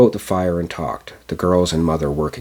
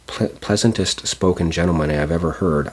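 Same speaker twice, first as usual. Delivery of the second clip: plain reading voice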